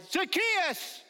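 high, loud call of the name; crying out Zacchaeus. Retold or imitated. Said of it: "One cried".

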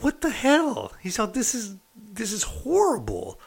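high, strained mock exasperation; appalled lieutenant. Lieutenant appalled by the typed write-ups, high-pitched exasperation.

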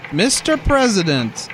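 ordinary talk, nothing marked

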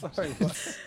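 laughing. Real, not acted. Teasing a cohost, asking again while laughing. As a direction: repeat it through a laugh. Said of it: "Hair sniffer".

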